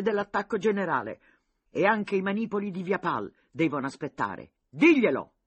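high, loud excited commands; urgent orders. A narrator's reading. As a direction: push the aide to run now. General gives urgent battle orders. (ordini concitati, alti e forti)

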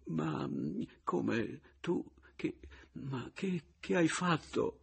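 faint, halting, tearful stammer; sob-broken stammer. A narrator's reading. Weeping wife questions returned husband, stammering through sobs. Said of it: "con voce rotta dai singhiozzi balbettò".

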